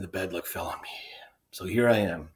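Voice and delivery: whispered, defeated confession; whispering. Acted. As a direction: whisper it in resignation. Sentry confesses drawing the lot.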